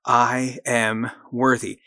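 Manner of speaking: slow, deliberate, every word weighted; slow affirmation. Shown as a demonstration. Host leading listeners in an affirmation, slow and deliberate. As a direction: lay it down slowly so they can repeat it. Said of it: "Say it with me again".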